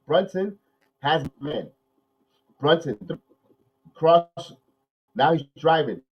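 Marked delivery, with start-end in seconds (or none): none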